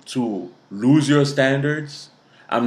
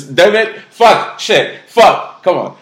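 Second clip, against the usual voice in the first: loud, high-pitched exasperated outburst; real burst of exasperation; frustrated cursing; 8 semitones higher, 11 dB louder, slower than usual. Real, not acted. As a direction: swear at yourself after another flub. Frustrated at repeated tongue slip.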